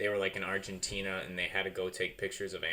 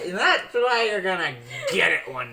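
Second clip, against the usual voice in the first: laughing threat; 12 semitones higher, 12 dB louder than usual. Real, not acted.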